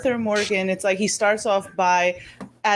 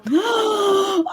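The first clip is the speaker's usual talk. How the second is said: sharp high gasp of delight; 11 semitones higher than usual. Real, not acted. Hearing a friend plays favorite game.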